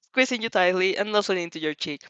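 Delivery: ordinary delivery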